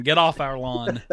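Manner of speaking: a lightly voiced grumpy old man, a bit slow; slow the line down like a cranky old neighbor, mock-grumbling; old man grumble. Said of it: "our old man soapbox".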